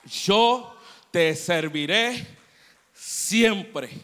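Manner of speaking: very loud, slow, rising to a peak; impassioned vow. Acted. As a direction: cry the promise out with all your might. Girl's vow repeated, climaxing. (promesa clamada muy fuerte y lenta, en crescendo)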